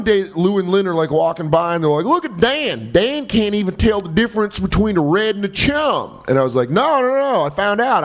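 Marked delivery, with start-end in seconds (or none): 2.1-6.2 mocking Southern boss, pitched high; high, hammed-up Southern accent. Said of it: "Southern accent". 6.7-8.0 eager protest. Retold or imitated. Acted protest to mocking bosses.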